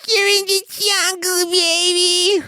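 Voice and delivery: slow, very high falsetto wail; hair-metal screech. Acted. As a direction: screech it like you own the dance floor. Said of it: "thinking that you're the hottest person on the dance floor".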